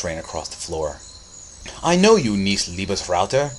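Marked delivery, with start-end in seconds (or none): 1.8-3.5 exclaiming. A narrator's reading. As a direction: exclaim it across a crowded room. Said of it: "exclaimed father around him".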